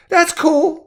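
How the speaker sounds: put-on very high, loud kid voice; second child. A narrator's reading. Boy approving of neighbor's move, high kid voice. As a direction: chirp it like a young boy. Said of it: "the second child said".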